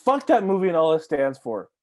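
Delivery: usual speaking voice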